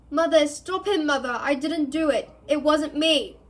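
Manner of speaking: high, loud sobbing denial; frantic pleading. Acted. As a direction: plead wildly with your mother. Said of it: "screamed the boy below".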